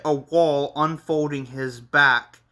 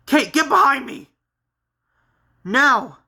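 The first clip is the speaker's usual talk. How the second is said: high, loud protective order; urgent command; 10 semitones higher, 6 dB louder than usual. Acted. Henry ordering Kate out of danger, urgent shout.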